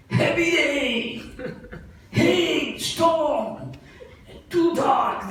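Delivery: high, laughing exclaiming of the storm; excited storytelling. A narrator's reading. Storyteller gleefully exclaims the storm hitting the dacoits. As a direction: pitch it up and relish the chaos.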